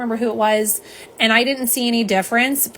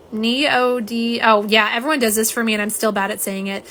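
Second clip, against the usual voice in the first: usual speaking voice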